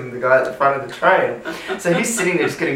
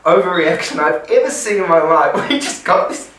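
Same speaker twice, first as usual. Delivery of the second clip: ordinary delivery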